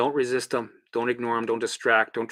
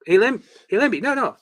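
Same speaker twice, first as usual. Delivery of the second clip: higher, pleading little voice, lightly played; mock pleading with Limby; 10 semitones higher, 4 dB louder than usual. Talking to his anxiety "Limby", mock pleading. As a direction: beg the anxiety to stop, half-joking.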